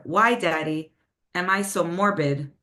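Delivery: ordinary delivery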